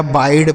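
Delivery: बाइड with an 'eye' diphthong; mispronounced बाइड. Shown as a demonstration. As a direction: say बाइड wrongly, i as in bike. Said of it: "गलत उच्चारण है".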